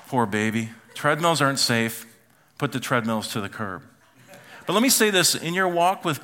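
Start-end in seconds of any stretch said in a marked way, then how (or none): none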